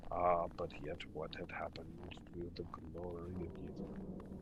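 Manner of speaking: low, soft, breathy ghostly lament; a lost spirit grieving over rubble, quietly; mournful ghost. Acted. Ghost laments the fall of Ythryn, low and whispery.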